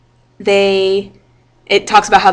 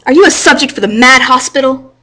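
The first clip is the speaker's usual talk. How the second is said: a mild scolding rebuke, pushed up and louder; 5 semitones higher, 5 dB louder than usual. Acted. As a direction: scold the joker for writing on the wall. Shopkeeper scolds joker, read aloud.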